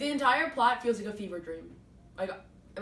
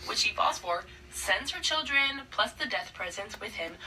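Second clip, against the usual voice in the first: normal talking voice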